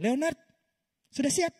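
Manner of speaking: tinggi dan keras, menantang; dosen menantang. Retold or imitated. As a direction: panggil tinggi seperti dosen angkuh. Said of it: "Dokter tanya". (dosen menantang mahasiswanya, tinggi dan lantang)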